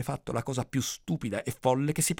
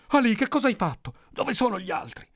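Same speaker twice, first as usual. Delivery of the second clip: higher-pitched anxious demand; urgent questioning; 10 semitones higher than usual. Acted. Villagers question the lone boy. (domanda concitata, acuta)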